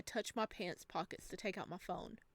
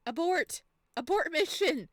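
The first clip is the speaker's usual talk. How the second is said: loud mock panic, high; panicked shout; 7 semitones higher, 8 dB louder than usual. Real, not acted. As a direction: yell to call off the mission. Panics after embarrassing slip.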